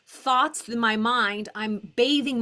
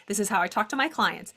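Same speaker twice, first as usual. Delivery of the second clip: ordinary talk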